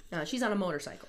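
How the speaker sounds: ordinary talk, nothing marked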